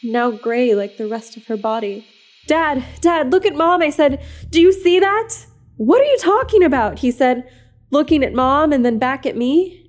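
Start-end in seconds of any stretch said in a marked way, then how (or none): 2.5-5.3 terrified. Acted. Terrified boy calls his dad. 5.8-7.0 bewildered. Acted. Confused father snaps.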